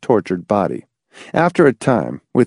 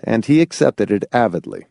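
usual speaking voice

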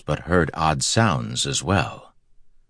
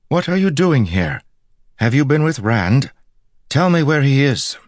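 high, raised voice, firing questions; demanding with authority; 10 semitones higher, 5 dB louder than usual. A narrator's reading. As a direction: take command and demand answers. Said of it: "She spoke with such authority now".

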